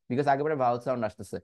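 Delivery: usual speaking voice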